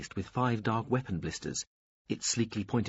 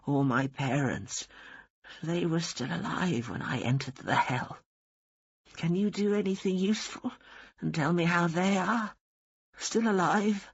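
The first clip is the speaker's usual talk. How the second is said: put-on pitched up, slow and dry old nun's voice; defiant old woman; 8 semitones higher than usual. A narrator's reading. Dying old woman defying the angel of death, dry and stubborn.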